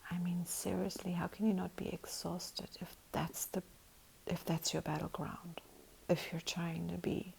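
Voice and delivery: quiet unvoiced whisper. Real, not acted. Soft whispered musing.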